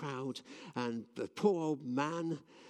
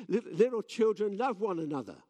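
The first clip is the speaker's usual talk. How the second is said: higher and louder, pushing the words out; louder for the crowd; 8 semitones higher, 8 dB louder than usual. Retold or imitated. Old John repeats to the crowd, louder. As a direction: raise your voice so the back can hear.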